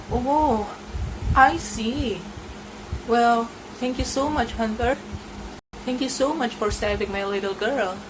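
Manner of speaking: put-on thanking the hunter for her girl; grateful mother.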